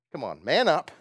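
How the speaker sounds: ordinary talk, nothing marked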